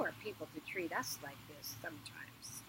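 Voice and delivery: hushed whisper aside. Acted. Inanna whispers bitterly to herself, hushed. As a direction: mutter it under breath.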